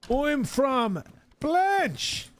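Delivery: high, slow, over-careful English; slow tourist English. Retold or imitated. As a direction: drag each word out for a foreigner. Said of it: "slowing down their English tune".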